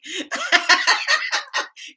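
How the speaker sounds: long, high peal of laughter. Real, not acted. Laughing at a viewer's guess, long high laugh. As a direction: cackle with delight.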